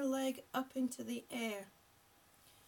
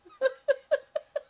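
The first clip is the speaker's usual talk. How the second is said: loud, high burst of chuckles; laughing; 12 semitones higher, 13 dB louder than usual. Real, not acted. Coach answering teasing viewer, loud high laughter. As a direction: laugh out hard in rapid pulses.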